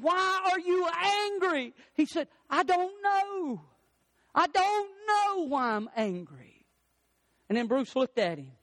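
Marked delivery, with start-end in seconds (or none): 0.0-1.7 high, stretched-out questioning cry; intense retold plea. Bruce confronting the angry man, high impassioned plea. 2.5-6.7 high, long-drawn cry; acted anguished outburst. Angry man answering the counselor, acted anguished cry.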